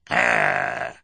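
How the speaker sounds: loud, low animal growl; deep, menacing "grrr"; wolf-baby growl. A narrator's reading. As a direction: growl a warning like a small beast. Baby playing wolf bares teeth.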